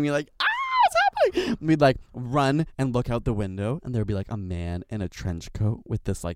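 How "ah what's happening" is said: high squealed panic; hammed-up scared squeal from kids; mock scared kids' scream. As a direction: shriek like frightened little kids. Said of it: "we'd all start screaming".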